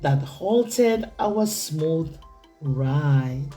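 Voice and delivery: ordinary delivery, nothing marked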